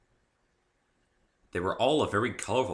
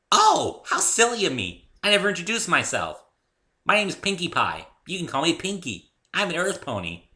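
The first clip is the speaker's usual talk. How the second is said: put-on much higher, louder giddy pony girl; bubbly Pinkie Pie; 10 semitones higher, 9 dB louder than usual. A narrator's reading. Cheerful pony introducing herself to Dave, high and bubbly. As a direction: chirp it out like an overexcited pony.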